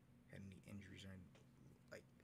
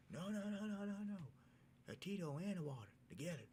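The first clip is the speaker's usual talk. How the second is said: higher and louder, insistent no no no; urgent correction; 10 semitones higher, 5 dB louder than usual. Retold or imitated. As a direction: stop the bartender mid-pour. Correcting the bartender.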